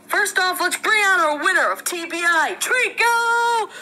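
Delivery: excited award-show host voice; excited announcer. Pretend. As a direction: call the winner out big. Announcing winner at ceremony.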